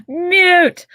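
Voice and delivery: high, loud teasing call; playful shout. Real, not acted. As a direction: call it out laughing. Teasing her friend to mute.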